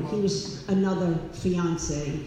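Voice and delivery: ordinary talk, nothing marked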